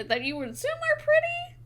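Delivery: high, fast squeak on pretty; squeaky pretty. Acted. GM jokes about fish ladies in a squeaky voice. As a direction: pop way up on pretty for comic effect. GM corrects herself, voicing pretty.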